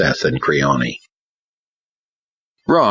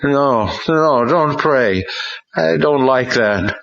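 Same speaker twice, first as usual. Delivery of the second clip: put-on higher, slow possessed boy's voice; mumbling boy; 9 semitones higher, slower than usual. A narrator's reading. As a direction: Ron refusing prayer, higher and slow. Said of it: "he mumbled".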